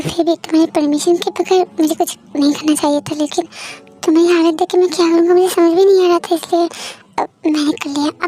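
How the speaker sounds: ordinary delivery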